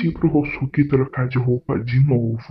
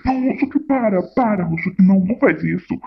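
high, panicked pleading; 6 semitones higher than usual. Real, not acted. Pranker begging husband to stop, high panicked plea. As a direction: beg him to stop, it's a prank.

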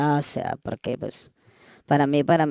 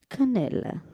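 put-on coy girly reply; 9 semitones higher, 7 dB quieter than usual.

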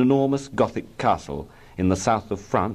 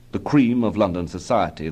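ordinary delivery, nothing marked

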